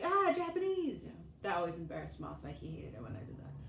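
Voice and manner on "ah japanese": high mock shout back; screaming back. Shouting back at the kids, high teasing call. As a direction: fire it right back at them, playful. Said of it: "so I'd scream back".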